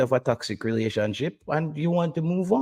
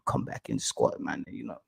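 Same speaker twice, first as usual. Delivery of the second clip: hushed, coaxing imitation of persuasion, much softer; whispering; 4 semitones lower, 7 dB quieter than usual. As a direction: lean in and murmur it like a sly recruiter. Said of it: "start whispering at me and say".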